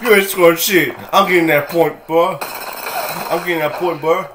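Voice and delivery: loud cocky trash-talker. Retold or imitated. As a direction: bark it like a smug opponent. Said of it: "He's like".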